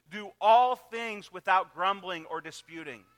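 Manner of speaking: scripture reading. Acted.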